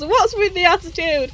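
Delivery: half-voiced imitation of a yell; retold yell. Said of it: "Furu grabs Ikazuchi and yells".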